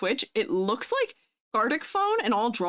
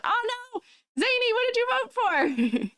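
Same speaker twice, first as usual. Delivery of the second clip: surprised; 12 semitones higher than usual. Real, not acted. Reacts to chat wanting to change vote.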